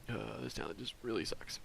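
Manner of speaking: hushed unvoiced aside; whispering. Real, not acted. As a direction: whisper it like a secret.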